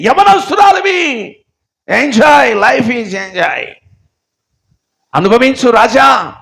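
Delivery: high, loud sermon at full force; fiery preaching. Real, not acted. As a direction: thunder it at the congregation. (ఉద్రేకంగా గట్టిగా ప్రసంగం)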